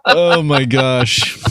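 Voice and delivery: laughing. Real, not acted. Recovering after joking about being a weirdo, laughing. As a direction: let the laugh stretch the words out. Cracking up at own remark.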